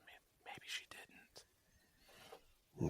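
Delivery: slow, hushed whisper. Acted. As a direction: whisper it drawn out, barely voiced. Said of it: "she's, like, whispering all this shit".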